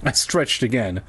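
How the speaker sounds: usual speaking voice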